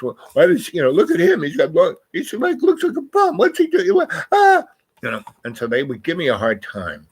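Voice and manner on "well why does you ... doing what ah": high, sneering voice of snobby academics; mocking scholars. Scholar in library sneering at scruffy reader, high mocking voice. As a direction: mimic the stuck-up professors jeering at him. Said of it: "And so all these scholastics".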